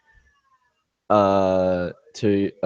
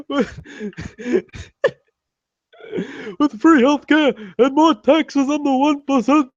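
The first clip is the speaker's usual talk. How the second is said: put-on high, strained old-man campaign voice; Bernie Sanders impression. Lampooning Bernie's campaign pitch in a high, strained voice. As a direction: pitch way up and rant like a stump speech. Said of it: "my Bernie Sanders impersonation".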